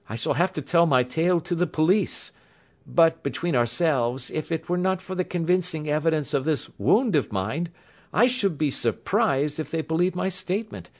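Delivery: high-pitched voice, earnestly recounting; injured young engineer. A narrator's reading. Patient telling the doctor how he bound his wound, earnest.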